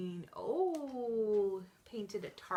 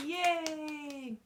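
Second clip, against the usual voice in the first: cheering; 5 semitones higher, 4 dB louder than usual. Real, not acted. Cheering after the singalong.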